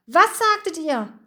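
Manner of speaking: high-pitched, startled question; startled awake. A narrator's reading. Bertram asks what they said, startled. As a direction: demand it, suddenly wide awake. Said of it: "auch Bertram war sofort hellwach".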